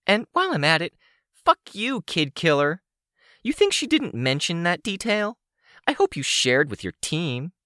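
high-pitched taunting villainess; high, scornful character voice; foul-mouthed villain woman. Acted. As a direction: needle her in a high, hostile voice. Sidepiece rounds on Rain.

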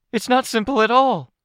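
protesting, with the voice near breaking. A narrator's reading. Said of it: "raised her voice with an emotional hitch".

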